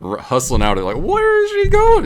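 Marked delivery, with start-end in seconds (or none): 1.0-2.0 high, loud puzzled cry; baffled, calling out where she went; shrill high mock bewilderment; bewildered. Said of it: "we're like".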